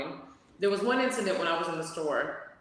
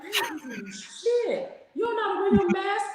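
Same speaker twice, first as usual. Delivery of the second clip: outraged retort; 13 semitones higher, slower than usual. Retold or imitated.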